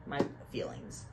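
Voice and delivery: soft unvoiced whisper, wounded; hurt whisper. Real, not acted. Vlogger to viewers about the sad ending, whispered. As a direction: murmur it like the book really hurt you.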